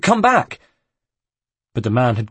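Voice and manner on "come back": high, loud, urgent calling out. Acted. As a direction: call him back, desperate. Man vanishes, Ezio calls after.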